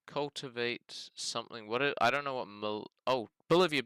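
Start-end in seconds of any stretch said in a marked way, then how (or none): 3.1-3.8 loud, high burst of recognition; sudden realisation. Real, not acted. Player spotting the town on the map, excited realisation. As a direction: blurt it out as it clicks.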